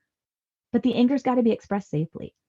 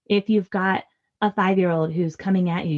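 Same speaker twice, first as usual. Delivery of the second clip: normal talking voice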